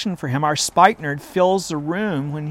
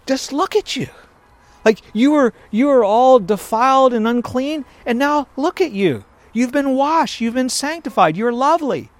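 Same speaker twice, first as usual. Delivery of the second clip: higher, admiring voice of God to his bride; God speaking tenderly; 6 semitones higher than usual. Retold or imitated. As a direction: marvel at her as God would. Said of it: "As if to say".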